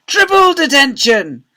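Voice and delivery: slow, high, loud shout; angry shout. A narrator's reading. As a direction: escalate it furiously. Deputy head escalates the punishment.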